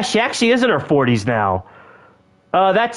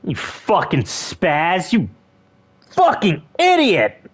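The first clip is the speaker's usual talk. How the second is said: exasperated cursing; slower than usual. Pretend. Cursing the brother who broke things, mock-angry. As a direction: stretch the insult out, rising in anger.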